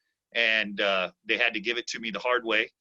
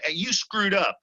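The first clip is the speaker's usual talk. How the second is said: a hint of a scolding coach, higher; mock scolding; 7 semitones higher than usual. Coach imitating the harsh way to scold a kid, mildly. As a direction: snap it lightly like a mild reprimand. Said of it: "rather than coming".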